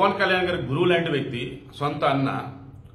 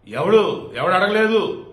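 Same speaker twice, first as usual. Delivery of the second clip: louder, retold shout of ఎవడు; angry outburst quote; 4 semitones higher, 7 dB louder, much slower than usual. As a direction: bark it like a heated assembly retort. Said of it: "మన అసెంబ్లీలో బాలకృష్ణ ఆ".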